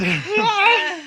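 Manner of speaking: a loud, high burst of real laughter. Real, not acted. Cohost nearly knocks something over, then bursts out laughing. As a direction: break into a startled laugh of relief. Near-miss laugh after almost spilling.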